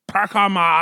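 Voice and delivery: punchy high shout; shouted catchphrase. Pretend. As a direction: yell it out like a signature call. Shouted catchphrase for a fantasy pick.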